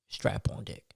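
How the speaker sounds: breathy whisper of the title; whispered aside. Real, not acted. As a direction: drop it as a hushed punchline.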